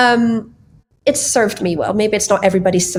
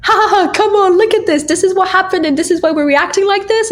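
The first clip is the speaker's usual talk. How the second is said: jolly husband laughing it off; 11 semitones higher than usual. Retold or imitated. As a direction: laugh it off like the dad who defuses a meltdown. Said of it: "he'll just go like".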